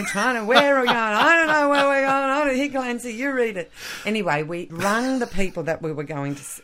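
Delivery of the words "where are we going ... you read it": higher, louder mock yelling of a lost driver; retold panicky shout at the map reader; imitating a yell. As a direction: play the flustered husband shouting from the wheel. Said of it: "screaming at me, yelling at me".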